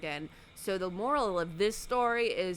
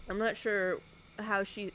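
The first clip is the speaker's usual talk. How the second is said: normal talking voice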